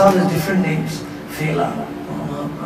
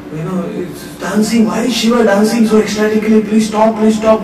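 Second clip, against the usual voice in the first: louder, higher frantic begging; pleading demigods; 6 semitones higher, 7 dB louder than usual. Acted. Demigods begging the dancing Shiva, frantic plea. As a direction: beg him to stop, urgently.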